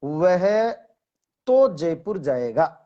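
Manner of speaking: slow, deliberate weight on तो; तो set apart and emphasized; stress on तो. Shown as a demonstration. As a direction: lean hard on तो, stretching the phrase out. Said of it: "जिसमें से एक बलाघात है".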